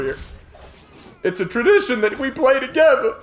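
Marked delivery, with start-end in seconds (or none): 1.2-3.2 very high, hammed-up plea; mock emotional. Streamer defending a tradition with brother, mock emotional. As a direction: squeak it out with exaggerated feeling.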